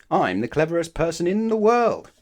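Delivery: high, loud proud announcement; loud boast. Acted. Mister Clever boasting loudly to Mister Happy. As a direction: trumpet it to the stranger.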